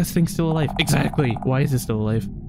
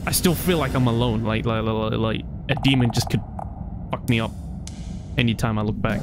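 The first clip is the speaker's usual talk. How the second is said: ordinary talk, nothing marked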